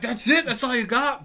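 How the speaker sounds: high, loud cocky challenge; cocky taunt. Retold or imitated. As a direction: taunt the hit like a fighter. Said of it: "it was kinda like".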